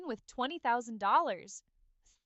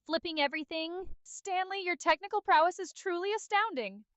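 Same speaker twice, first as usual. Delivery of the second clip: higher-pitched mock amazement; scoffing; 7 semitones higher than usual. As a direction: echo it back in scornful disbelief.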